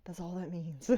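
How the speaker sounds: hushed side comment to the audience; whisper. Retold or imitated. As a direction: whisper it like a secret aside. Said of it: "I almost wanna be like".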